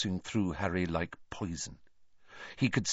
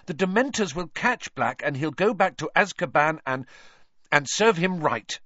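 high, urgent pleading; distressed arguing; 11 semitones higher, 5 dB louder than usual. A narrator's reading. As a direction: argue anxiously at raised pitch. Stricken Hermione argues with Harry.

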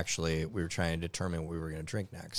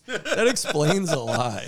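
laughing; 8 semitones higher, 7 dB louder, slower than usual. Real, not acted. Laughing realisation.

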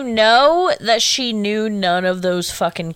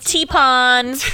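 normal talking voice

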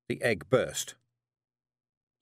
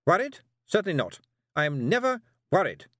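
higher, louder sharp snap; snapped denial; 9 semitones higher, 5 dB louder than usual. A narrator's reading. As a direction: bristle at the very idea of worry. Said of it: "snapped Miss Tick".

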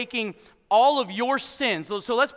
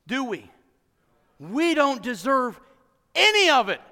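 ordinary talk

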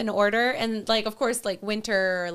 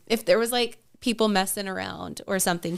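ordinary talk

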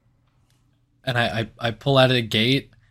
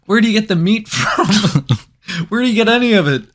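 laughing disbelief; 8 semitones higher, 5 dB louder than usual. Real, not acted. Laughing incredulous questions.

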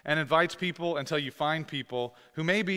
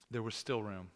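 quieter, lower repeat of still room; soft echo; 3 semitones lower, 7 dB quieter than usual. Shown as a demonstration. Pastor echoing the line softly to the congregation. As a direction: let still land softly the second time. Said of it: "repeats the line for emphasis".

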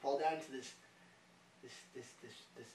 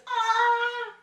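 long, high, loud wail; hammed-up agony cry; mock pained scream; 16 dB louder than usual. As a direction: scream as if sliced by paper. Acting cries at falling paper cuts.

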